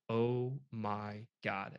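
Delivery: drawn-out, low, stunned murmur; stunned disbelief. Retold or imitated. Hunter reacting to the butchered elk, stunned. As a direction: let it sink in slowly, softly.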